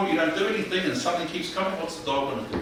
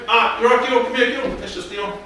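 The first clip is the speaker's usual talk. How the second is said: higher, louder friendly call; 8 semitones higher, 6 dB louder than usual. Retold or imitated. Owner greets a familiar visitor, calling out loudly. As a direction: call the visitor over cheerfully.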